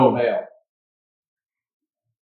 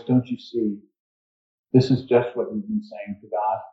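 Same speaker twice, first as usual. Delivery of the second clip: a hushed, lowered voice, a bit softer; low voice; 3 semitones lower, 6 dB quieter than usual. Retold or imitated. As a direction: speak low and earnest to land the point. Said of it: "he said in a low voice".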